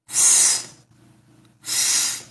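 the s written here is a voiceless th, tongue between the teeth; voiceless th hiss; th sound. Shown as a demonstration. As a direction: push air out over the tongue between the teeth for s. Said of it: "colocando a língua entre os dentes".

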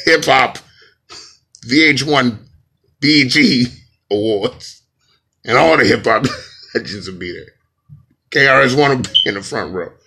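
loud, emphatic mock announcement; booming orders with bits of laughter; Illuminati voice. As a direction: lay down the orders as a secret-society boss. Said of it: "Okay, Illuminati voice".

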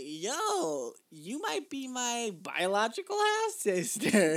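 high, loud, drawn-out announcement; acted-out excited message. Acts out messaging a possible half-sister.

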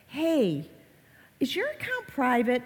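lightly voiced parent checking up on a child; confronting parent. Parent going up to the kids about their account, confronting.

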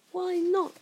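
high, loud, insistent question; young voice demanding a reason; puzzled boy. A narrator's reading. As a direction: push back with pitch lifted. Harry questions the reluctance.

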